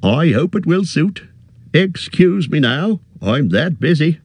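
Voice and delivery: a fussy, friendly innkeeper bending down; cheery innkeeper. A narrator's reading. Innkeeper greets the hobbit, high and bustling.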